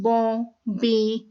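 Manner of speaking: "बों" and "बे" drawn out slowly with a nasal ending; slow nasal demo. Shown as a demonstration. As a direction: hold the nasal vowel of "बों". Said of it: "sometime बों भी कहते हैं".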